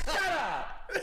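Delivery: high, cut-off mobster outburst; mock shout. Al refusing to talk about it, high mock shout. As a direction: shut him down with a high imitated yell.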